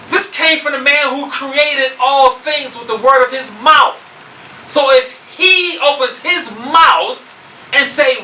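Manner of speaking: slow, high, loud pronouncement; full-force preaching. Real, not acted. Preacher warns those not following the Creator's word, full-force preaching. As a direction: thunder the judgment from the pulpit.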